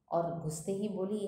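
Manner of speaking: usual speaking voice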